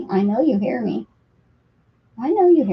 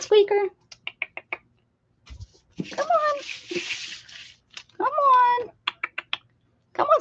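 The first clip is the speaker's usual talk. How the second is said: high pet call; 10 semitones higher than usual. Real, not acted. Owner coaxing Squeak with a high sing-song call. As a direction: coax the kittens to come.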